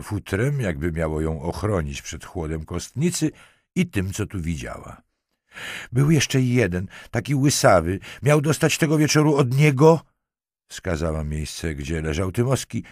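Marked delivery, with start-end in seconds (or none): 5.9-10.0 high, loud, frantic telling; distraught. A narrator's reading. Marquise confesses in the morgue, distraught. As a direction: blurt it out at a raised pitch. Terrified witness blurts out testimony.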